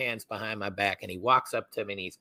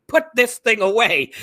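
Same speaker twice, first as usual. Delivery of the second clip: loud, high imitation of a commanding officer; imitated captain's shout; retold shouted order; 13 semitones higher, 12 dB louder than usual. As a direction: yell it as an urgent command.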